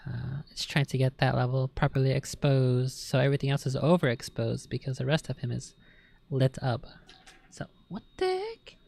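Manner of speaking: normal talking voice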